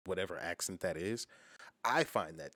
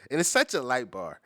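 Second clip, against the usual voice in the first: loud, higher burst of hype on such; excited exclamation; 6 semitones higher, 10 dB louder than usual. Real, not acted. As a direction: blurt it out, hyped by the bar. Hyped reaction to a bar.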